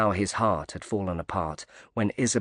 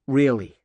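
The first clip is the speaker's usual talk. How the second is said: "Really" pushed up and a bit louder; emphatic; 4 semitones higher, 6 dB louder than usual. A narrator's reading. Diver insisting to Knight that she means it, emphatic. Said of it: "Pierce said emphatically".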